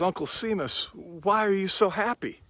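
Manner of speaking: high, eager question; a lifted, delighted voice for the nephew; curious nephew. Retold or imitated. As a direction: ask him in bright wonder. Nephew asks uncle in story.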